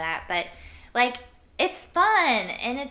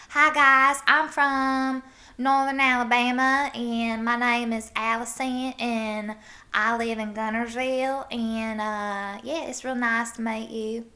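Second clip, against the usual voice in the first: slow Northern Alabama drawl, consonants dropped; Northern Alabama accent; 3 semitones higher, 4 dB louder than usual. Shown as a demonstration. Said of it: "Northern Alabama is, you kinda drop your consonants".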